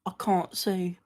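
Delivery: lightly put-on British accent; Gibby impression. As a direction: say it with a playful British lilt. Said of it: "doing an impression of Gibby".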